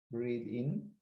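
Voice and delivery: normal talking voice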